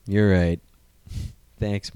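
normal talking voice

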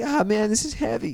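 high-pitched movie-teen impression; Marty McFly. Marty reacting to time-travel trouble, high impression. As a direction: pitch it up like the awed movie kid. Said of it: "But he's just like".

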